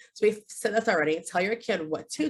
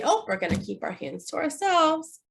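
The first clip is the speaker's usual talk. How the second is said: higher, kind teacher voice; positive redirect; 8 semitones higher than usual. Shown as a demonstration. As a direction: coax gently. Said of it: "We can say".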